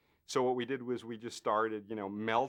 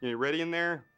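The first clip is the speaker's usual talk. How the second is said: louder call into a box; 7 semitones higher, 6 dB louder than usual. Retold or imitated. Knocking on the box, calling to the effects guys. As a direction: call to the hidden crew. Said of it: "I'm knocking on the little, you know, box".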